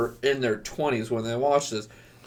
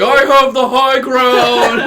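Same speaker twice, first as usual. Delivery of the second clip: higher, louder, slow, laughing declaration; mock Lotso line; 11 semitones higher, 12 dB louder, slower than usual. Speaker mock-quoting Lotso, loud high laughing declaration. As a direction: proclaim it like a hammed-up villain. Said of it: "Lotso going".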